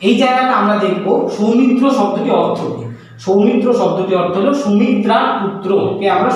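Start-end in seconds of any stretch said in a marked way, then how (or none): none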